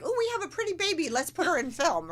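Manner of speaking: high, fast gushing parent voice; excited parent. Retold or imitated. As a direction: gush like a proud parent. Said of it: "your parents were like".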